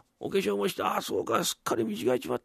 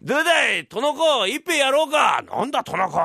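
tipsy loud invite; 11 semitones higher, 7 dB louder than usual. Acted. Tipsy Hachigoro addresses lord.

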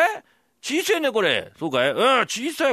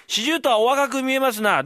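high, loud fawning praise; butter him up, a touch hammed; high flattering recital; mock flattery; 8 semitones higher, 4 dB louder than usual. Fool recites flattery line.

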